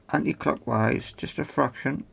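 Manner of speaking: ordinary delivery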